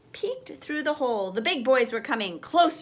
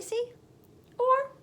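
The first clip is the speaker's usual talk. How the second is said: playful encouragement; 10 semitones higher than usual. Real, not acted. Teacher encouraging imagination.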